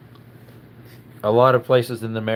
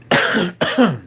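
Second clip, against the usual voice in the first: loud throat-clearing cough; harsh cough; 8 semitones higher, 6 dB louder than usual. Real, not acted. Coughing with a tickle in his throat.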